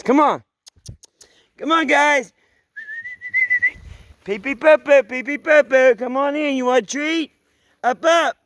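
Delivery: loud high calls to dogs; calling dogs loudly. Real, not acted.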